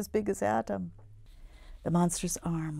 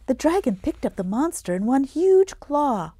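excited; 8 semitones higher, 11 dB louder than usual. A narrator's reading.

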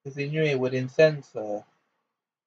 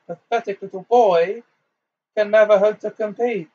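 high, loud dark witch boasting; boastful villainess; 6 semitones higher, 5 dB louder than usual. A narrator's reading. Dark witch boasts of her master to Potter, high and scornful. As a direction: sneer at the boy, proud and loud.

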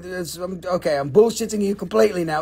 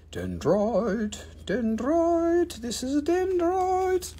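goofy voice; 7 semitones higher, 6 dB quieter, much slower than usual. Pretend. Goofy repeated word, voice put on.

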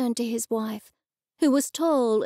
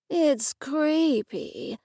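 very slow, higher-pitched disdain; drawling distaste; 4 semitones higher, much slower than usual. A narrator's reading. Daughter picking up mother's porcelain doll, drawling distaste. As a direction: stretch it out with disgusted relish.

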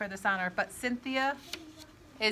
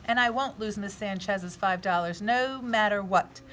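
plain reading voice

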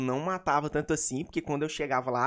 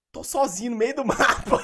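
high, loud, breaking into a laugh; laughing exasperation; 9 semitones higher, 7 dB louder than usual. Real, not acted. Player telling fans he got left alone, laughing exasperation. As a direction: burst out laughing at your own bad luck. Exasperated retelling of being stranded.

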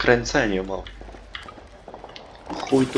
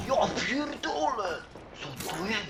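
amazed; 12 semitones higher, 7 dB quieter, slower than usual. Real, not acted. Amazed at purple ammo.

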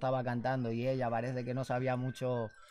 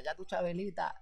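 lightly voiced fan, higher pitch; fans quoting him; 6 semitones higher, 6 dB quieter than usual. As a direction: greet him on the street with his catchphrase. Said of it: "me veía por la calle y me decía". (fan por la calle repitiendo la frase, algo más agudo)

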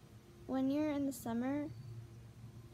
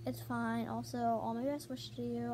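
usual speaking voice

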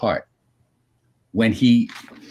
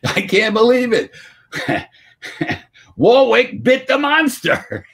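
high, laughing disbelief, slow on the second line; amazed Stephen King laughing; 11 semitones higher, 3 dB louder than usual. Retold or imitated. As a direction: squeal it in delighted shock. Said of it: "Stephen King saying".

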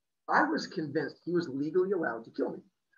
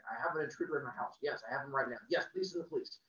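softer, lower, fast phone report; phone call; 5 semitones lower, 9 dB quieter, faster than usual. Retold or imitated. Neighbour calling police, hushed phone report.

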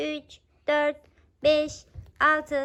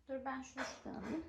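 quick mutter; 2 semitones lower, 19 dB quieter, much faster than usual. Real, not acted. Quick aside while hiding.

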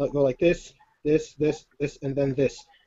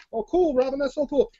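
imagined admiring spectator; 9 semitones higher than usual. Pretend. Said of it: "by way of saying".

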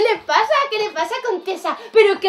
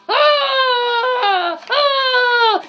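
loud, higher roaring shouts of a lion; 7 semitones higher, 5 dB louder than usual. Acted. Storyteller plays the furious lion for kids, loud roars. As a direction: roar like an angry lion.

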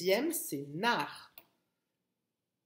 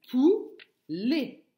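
"poulet" slow and loud, in two pieces; split into syllables; 3 semitones higher, 7 dB louder than usual. Shown as a demonstration. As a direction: stretch and separate both syllables of "poulet". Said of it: "la première syllabe".

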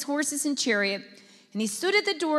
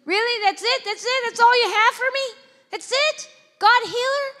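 high, loud disbelieving protest; outraged that's-all-you-got; high, loud, mock-indignant complaint; incredulous; 11 semitones higher, 8 dB louder than usual. Indignant wife to husband.

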